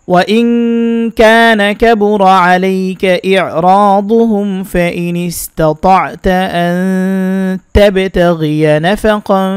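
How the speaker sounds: slow melodic tajweed recitation; Quran recitation. Acted. Teacher reciting the verse in full for viewers, slow melodic recitation. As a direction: chant each phrase long and measured.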